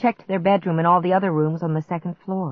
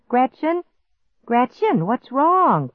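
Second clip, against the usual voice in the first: higher-pitched, questioning call; concerned call; 7 semitones higher, slower than usual. A narrator's reading. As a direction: call out with mild alarm. Clark calls out, alarmed.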